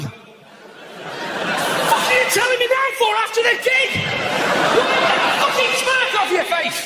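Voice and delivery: mock-furious rant at the mate after the gig; exasperated outburst. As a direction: blow up at him in disbelief.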